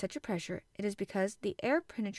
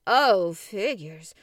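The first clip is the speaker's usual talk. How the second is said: scoffing; 11 dB louder, much slower than usual. A narrator's reading. Ned scoffs at figures.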